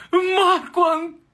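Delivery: very high, loud call of his name; delighted greeting. Acted. Pretty receptionist greeting him in a very high, loud gush.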